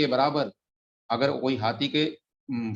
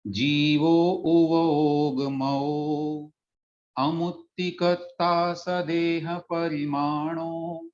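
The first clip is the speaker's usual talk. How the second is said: very slow chanted reading of the verse, each word held long; verse recitation; 3 semitones higher, much slower than usual. Shown as a demonstration. Teacher reads the verse aloud to the class before explaining it, slow chant. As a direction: intone the Prakrit verse like a scripture reading. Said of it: "तो एक बार पुनः पढ़ता हूँ इसको".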